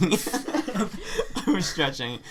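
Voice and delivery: slow words through laughter; laughing. Real, not acted. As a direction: laugh it out slowly. Repeats it, laughing hard.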